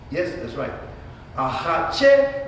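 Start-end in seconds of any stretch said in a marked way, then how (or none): 1.3-2.5 "hache" lifted on its last syllable; stress on final syllable. Shown as a demonstration. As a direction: lean on the end of "hache". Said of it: "I told you about accents".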